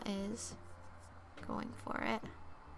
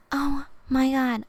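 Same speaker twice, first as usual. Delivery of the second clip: louder, slow, dismayed; alarmed oh my God; 3 semitones higher, 7 dB louder, slower than usual. Real, not acted. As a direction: gasp it out in alarm. Phone suddenly dies mid-stream.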